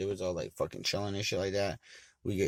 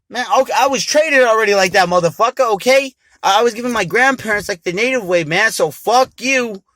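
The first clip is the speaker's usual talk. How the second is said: much higher, loud furious yelling; furious shouting; 11 semitones higher, 13 dB louder than usual. Retold or imitated. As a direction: yell it, outraged. Furious at devil's offer.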